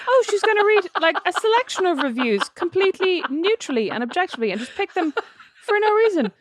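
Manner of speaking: high-pitched sarcastic self-quote; mock naive. Host teasing co-host over chosen reviews, mock naive. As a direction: play up the gullible thought, half laughing.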